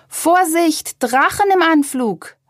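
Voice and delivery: high, urgent warning call; warning shout. A narrator's reading. Beetle warning the others of an incoming kite, shouting. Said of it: "rief Lucius".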